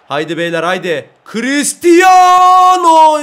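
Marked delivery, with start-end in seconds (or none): 1.3-3.2 very high, loud drawn-out name; long excited shout. Real, not acted. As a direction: stretch the name in a yell. Big chance, shouting name.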